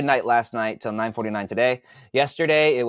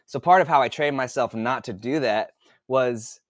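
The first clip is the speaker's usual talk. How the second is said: ordinary delivery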